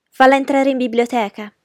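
high, loud imperious command; haughty young lady taking charge; interrupting order. A narrator's reading. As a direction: cut in with an order. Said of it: "interruppe l'angelo".

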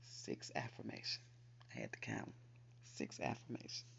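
hushed aside, voice dropped to a whisper. Real, not acted. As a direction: murmur it under your breath. Aside while counting affirmations.

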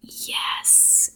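breathy hushed approval, almost voiceless; whispered yes. Retold or imitated. Viewer cheering the final girl in a whisper. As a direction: whisper it like a delighted secret. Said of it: "And I was like".

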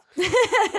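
high squealing laugh; sudden loud laugh; burst of laughter. Real, not acted. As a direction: crack up loudly.

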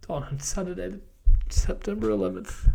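pushing the words out while crying; emotional, teary. Real, not acted. Runner confessing his hardest day, choked up. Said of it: "I just got a bit emotional there".

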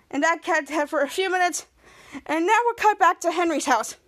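usual speaking voice

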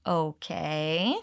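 soft Okay rising at the end like a question; a puzzled, asking Okay. Shown as a demonstration. Teacher repeating the coworker's puzzled reply, rising. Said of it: "Repeat the intonation".

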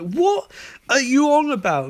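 high, incredulous outburst; hammed-up disbelief; mock bafflement. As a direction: imitate a baffled hero, voice shooting up. Baffled hero in retold exchange.

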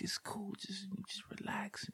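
smooth. Retold or imitated. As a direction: coo it softly to calm her. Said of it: "try to be all smooth".

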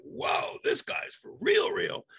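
airy whoa, then a high, slow for real; impressed surprise. Retold or imitated. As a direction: sound blown away and impressed. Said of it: "he was like".

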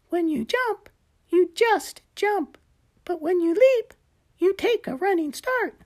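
high little gecko explaining. Acted. Gecko voiced by narrator.

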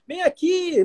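lightly voiced kid calling mom over; child calling. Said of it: "tô gritando".

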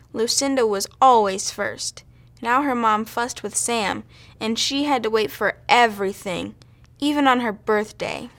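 ordinary delivery

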